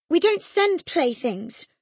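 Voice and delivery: high, slow indignant retort; proud retort. A narrator's reading. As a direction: fling it back with wounded pride. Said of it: "her pride overcoming her shame".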